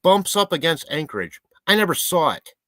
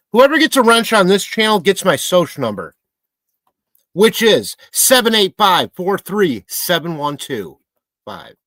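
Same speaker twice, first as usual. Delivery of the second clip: mock announcement; 4 semitones higher, 6 dB louder than usual.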